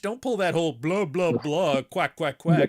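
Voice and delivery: mock someone's fancy French as babble; mocking gibberish. Mocking the fluent French speaker's talk.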